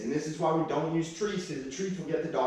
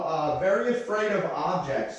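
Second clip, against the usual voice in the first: ordinary talk, nothing marked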